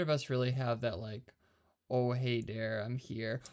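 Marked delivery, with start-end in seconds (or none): none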